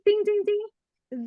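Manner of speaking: high, loud "ding, ding, ding"; imitated bell. Shown as a demonstration. Teacher signals the moment to start counting, imitated bell.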